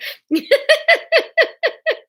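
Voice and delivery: real high, loud giggling fit; helpless burst of laughter; cracking up laughing. Real, not acted. Narrator laughs at her own failed accent, cracking up.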